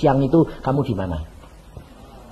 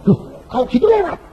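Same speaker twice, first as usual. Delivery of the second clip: surprised; 9 semitones higher, much faster than usual. Retold or imitated. Man realises deadline passed.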